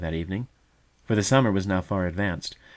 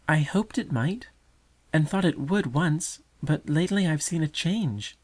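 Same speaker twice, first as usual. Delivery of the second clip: put-on higher, earnest childlike voice; fledgling answering mother, higher and earnest; young bird; 8 semitones higher than usual. A narrator's reading.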